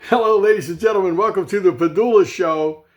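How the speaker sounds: showman welcome. Real, not acted. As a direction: open the show like a game show host. Show host opening greeting.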